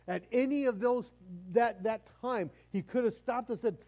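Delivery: high, loud, driving sermon voice; impassioned preaching. Real, not acted. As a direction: preach it at full force. Preaching at full force.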